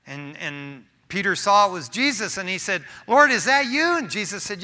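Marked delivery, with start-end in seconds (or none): 3.1-4.0 high, loud call across the water. Acted. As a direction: call out to him, unsure. Peter calling across water.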